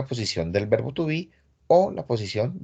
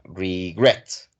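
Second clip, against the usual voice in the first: regret with the stress landing on GRET; stress on second syllable; 4 semitones lower than usual. Shown as a demonstration. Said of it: "el acento va en la segunda sílaba".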